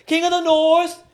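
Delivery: very high, loud, grand proclamation; mock epic proclamation. Mocking storm panic, hammed-up grand proclamation. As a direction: announce it like a fantasy herald.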